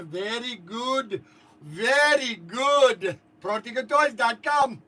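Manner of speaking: put-on loud, drawn-out Indian-accented sign-off; emphatic put-on Indian accent. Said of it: "I'm going to do my Indian accent for TikTok".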